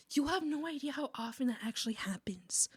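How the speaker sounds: soft, half-whispered exclamation; hushed aside. Real, not acted. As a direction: confide it in a near whisper. Hushed aside about frequent infighting.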